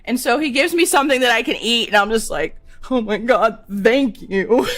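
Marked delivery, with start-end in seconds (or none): 2.9-4.7 louder, drawn-out relieved thanks; mildly hammed-up gratitude. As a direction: gush a thank-you, breaking into laughter. Retold thanks for getting food.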